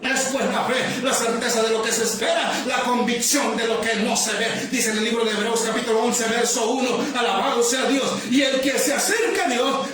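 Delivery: forceful, driving sermon voice; impassioned pulpit delivery; fervent preaching. Real, not acted.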